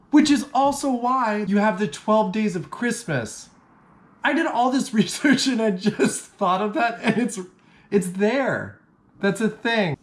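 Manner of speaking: high-pitched, giddy amazement; excited realisation. Real, not acted. Vlogger just thought of the connection, excited and amazed. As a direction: blurt out a discovery you just made.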